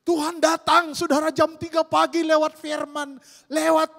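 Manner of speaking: loud, high preaching burst; fiery preaching. Acted. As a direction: proclaim it to the congregation at full force. Preaching at full force. (berkhotbah dengan suara keras dan tinggi)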